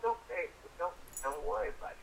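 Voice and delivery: ordinary talk, nothing marked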